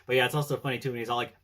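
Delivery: usual speaking voice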